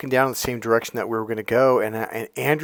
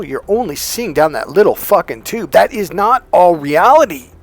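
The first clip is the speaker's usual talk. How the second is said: louder, higher, punching each word; forceful emphasis; 4 semitones higher, 7 dB louder than usual. Real, not acted. Coach scolding men's tunnel vision, forceful. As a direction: drive it home hard.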